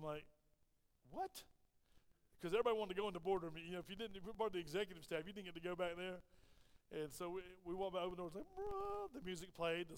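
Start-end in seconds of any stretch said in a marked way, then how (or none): 1.1-1.4 astonished. Retold or imitated. Teacher is offered the executive boardroom, astonished. 8.6-9.1 high sung-out imitation of a grand reveal; heavenly fanfare. Boardroom doors open to music and sunshine, heavenly fanfare.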